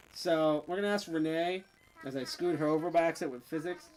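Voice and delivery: normal talking voice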